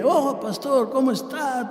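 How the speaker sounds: put-on high-pitched, over-chatty drunk greeting; talkative drunk. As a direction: greet like a drunk who has turned talkative. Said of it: "borracho, hablador".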